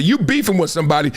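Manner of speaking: usual speaking voice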